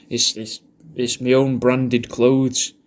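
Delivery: ordinary talk, nothing marked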